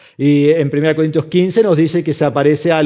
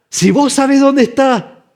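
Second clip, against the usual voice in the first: much higher, drawn-out insistence; urgent demand; 10 semitones higher, 4 dB louder, slower than usual. Acted. Mary pressing the gardener for her Lord, urgent. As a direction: demand an answer, acting desperate.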